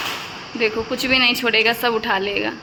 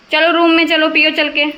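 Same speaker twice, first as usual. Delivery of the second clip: calling out; 5 semitones higher, 5 dB louder than usual. Real, not acted. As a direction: call him into the room. Calling baby to the room.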